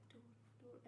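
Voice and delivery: imitating a learner softly trying out a word; mouthing to herself. Teaching helper imitates a student rehearsing a new word, mouthing to herself. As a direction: repeat it under your breath, as if practising. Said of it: "like, mouths it to herself".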